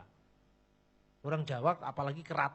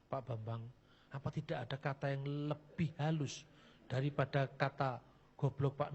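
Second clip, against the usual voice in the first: gentle rebuke; 4 semitones lower, 7 dB quieter than usual. Retold or imitated. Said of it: "ditegur salah seorang hamba Tuhan senior".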